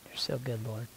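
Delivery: earnest spoken prayer, drifting soft and hushed as it goes on; heartfelt prayer. Real, not acted. Praying to the Lord before the group, intimate and hushed. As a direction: pray it out, pausing to listen.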